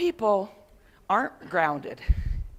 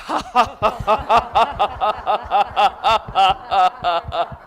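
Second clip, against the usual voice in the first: slow, low laugh from the belly; 7 dB louder than usual. Shown as a demonstration. Teacher leads the group in a deep belly laugh. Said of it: "Coming low into the belly".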